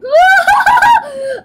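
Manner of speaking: loud burst of laughter. Real, not acted. Laughing at the game.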